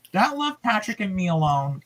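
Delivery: ordinary delivery, nothing marked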